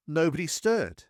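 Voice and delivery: usual speaking voice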